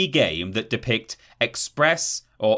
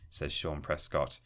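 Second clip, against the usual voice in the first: normal talking voice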